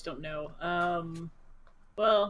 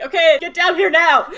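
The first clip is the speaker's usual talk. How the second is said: loud, high, urgent yell with laughter in it; shouting up; 8 semitones higher, 12 dB louder than usual. Acted. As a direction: holler up to the party as the character. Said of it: "Nyla shouts up".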